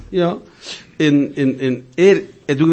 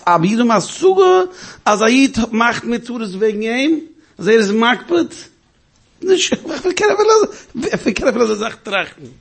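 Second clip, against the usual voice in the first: high pleading voice; 9 semitones higher than usual. Retold or imitated. Quoting the young man pleading. (hoch und langsam flehend)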